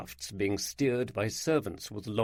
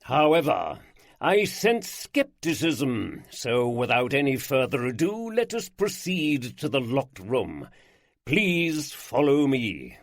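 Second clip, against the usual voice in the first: slow, louder theatrical lord proclaiming; grand showman lord; 2 semitones higher, 4 dB louder than usual. Acted. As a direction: unveil himself like a stage magician.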